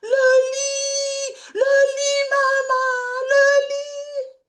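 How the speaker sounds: cri très aigu et fort de petit garçon émerveillé; cri d'émerveillement d'enfant. Retold or imitated. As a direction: crie ta joie comme l'enfant qui voit son premier lit. Enfant découvre un lit. (enfant qui crie d'émerveillement devant le lit, très aigu et fort)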